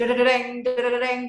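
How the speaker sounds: vocal drum roll, dereng dereng; drum imitation. Shown as a demonstration. Worship leader mimics drums for an epic ending. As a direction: mimic a building drum beat. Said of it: "mungkin drum".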